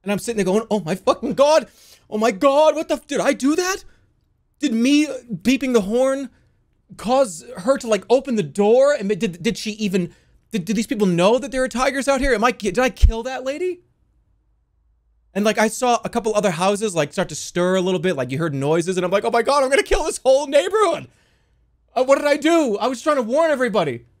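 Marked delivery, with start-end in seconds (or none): 0.7-13.8 higher, louder, frantic retold panic; a replay of horror at what I caused; panicked rush of questions; panicking. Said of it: "I'm panicking". 19.2-24.1 higher, louder, frantic retold panic; a replay of horror at what I caused; panicked rush of questions; panicking. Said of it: "I'm panicking".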